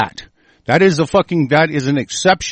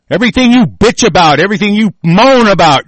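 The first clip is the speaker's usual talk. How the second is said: loud, higher, furious rant; swearing tirade; 4 semitones higher, 3 dB louder than usual. Real, not acted. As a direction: hammer home the blame. Escalating angry rant at listeners.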